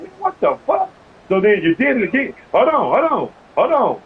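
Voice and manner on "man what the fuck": high, disbelieving exclamation; retelling his own shock at the play; incredulous. Retold or imitated. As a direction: throw 'Man, what the fuck?' way up in disbelief. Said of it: "I'm like, I'm like".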